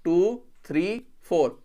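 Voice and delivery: 1 slow counting. Shown as a demonstration. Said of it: "तो अब देखो".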